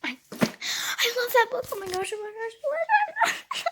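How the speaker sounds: high, excited gushing. Real, not acted. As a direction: squeal with delight. Gushing about the book.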